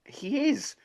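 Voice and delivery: high, insistent "He is"; teasing his missus, high insistent reply; insisting. Retold or imitated. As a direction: insist like a kid winning an argument. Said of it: "I went".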